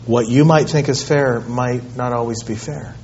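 soft, low parental reasoning; calm fatherly correction. Retold or imitated. Father tells his son about fairness, calm and quiet. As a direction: explain it quietly and patiently to the child.

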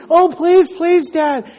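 high-pitched mock begging; pleading. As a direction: imitate a kid clinging and pleading with Dad. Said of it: "hold my legs and just plead".

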